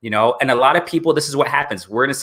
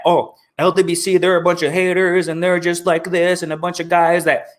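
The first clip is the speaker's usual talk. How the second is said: lightly put-on scoffing fan voice; mocking critics; 5 semitones higher than usual. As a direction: sneer it like a petty critic. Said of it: "they think that".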